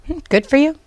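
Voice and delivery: high, sing-song mock praise; a dry, unimpressed Hmm, then sarcastic praise; sarcastic good for you. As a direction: say it with a skeptical eye-roll. Mocking the husband's claim.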